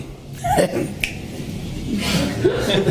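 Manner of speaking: soft airy laugh; a hushed, breathless chuckle; breathy laugh. Real, not acted. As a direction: laugh quietly at the punchline. Speaker laughs at own joke.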